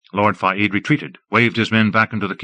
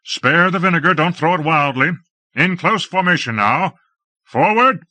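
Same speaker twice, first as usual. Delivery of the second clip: battle commands; 7 semitones higher than usual. A narrator's reading. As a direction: drive the troops forward. Lord commands troops in battle.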